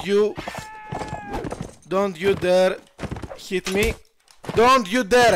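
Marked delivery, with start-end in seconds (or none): 4.5-5.3 louder warning. Real, not acted. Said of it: "I said".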